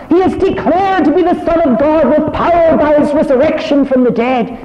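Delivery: high, loud, ringing proclamation; full-force preaching. Acted. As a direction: lift the whole room with the joy of it. Preacher proclaims Mary's message.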